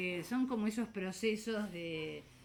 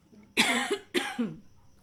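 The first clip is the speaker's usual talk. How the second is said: harsh choking cough; coughing fit; 6 semitones higher, 10 dB louder than usual. Real, not acted. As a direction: cough hard on a swallowed bug. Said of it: "Creo que me comí un mosquito".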